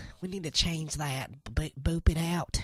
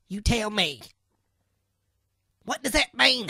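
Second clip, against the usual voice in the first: frustrated outburst; 6 semitones higher, 7 dB louder than usual. Acted. Frustrated at Trent over equipment.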